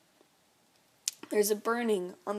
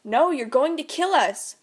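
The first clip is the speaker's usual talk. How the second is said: much higher, louder panicked cry; 6 semitones higher, 8 dB louder than usual. Acted. Mom screams that he will kill them, panicked. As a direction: scream at him in terror.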